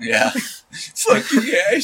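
high, slow words through laughter; laughing. Real, not acted. Laughing at the joke.

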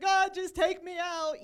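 fed-up cry to heaven, acted out; exasperated plea. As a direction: dramatically beg God to end it. Voicing despairing Elijah in cave.

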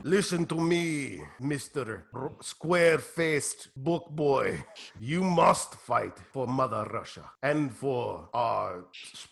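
low, slow Russian commander; Russian handler. Acted. Handler ordering the fighter to fight for Mother Russia, low and slow. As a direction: order him slowly in a deep Russian accent.

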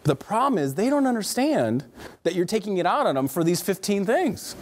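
ordinary talk, nothing marked

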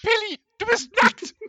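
very high, very loud shriek. Acted. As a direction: scream it in fake horror. Shrieked character reply.

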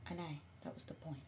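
very soft, voiceless aside; barely audible reply; deliberate whisper. Real, not acted. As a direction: whisper it under your breath. Admits whispering so nobody hears.

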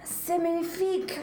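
put-on hammy French accent. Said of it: "listen to your accent".

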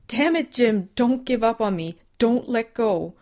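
high, loud, desperate pleading; desperate shout. Acted. Susan screaming at dying Jim not to give up, desperate shout. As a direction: yell at him to stay alive.

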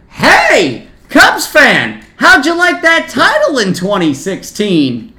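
high, loud taunting shout; full-voiced ballpark jeer; heckling yell. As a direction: holler a baiting question at a rival fan.

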